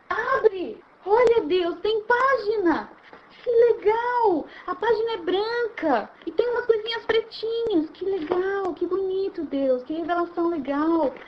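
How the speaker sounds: high, slow, marvelling exclamations; childlike wonder. Acted. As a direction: gush like a child opening a present. Acts childlike wonder at the book.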